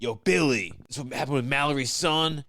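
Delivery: hammed-up confrontational impression; Mother's Milk. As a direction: imitate a man demanding to know, loudly. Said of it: "Mother's Milk will be like".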